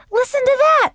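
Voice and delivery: high, loud and thrilled; delighted. A narrator's reading. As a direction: marvel at it. Delighted sister.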